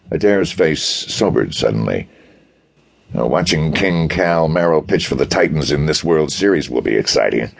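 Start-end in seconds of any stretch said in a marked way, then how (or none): none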